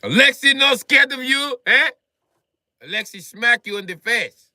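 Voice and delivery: hammed-up Russian tough guy threatening. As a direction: puff up as Alexei. Acting as tough Alexei.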